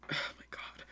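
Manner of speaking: breathless whispered oh my god; whispered dismay. A narrator's reading. Overwhelmed, muttering before running off.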